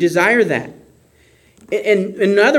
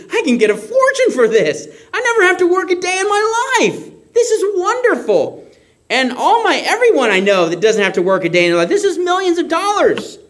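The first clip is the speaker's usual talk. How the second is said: giddy, much higher voice over the treasure, with a laugh; excited; 11 semitones higher than usual. Pretend. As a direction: gloat with delight at a fortune. Imagining finding hidden treasure.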